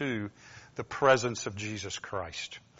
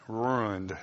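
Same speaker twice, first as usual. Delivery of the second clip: softer North Georgia "ruined"; North Georgia accent; 4 semitones lower, 6 dB quieter than usual. Shown as a demonstration. Preacher echoing his own word, North Georgia accent. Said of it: "That North Georgia accent".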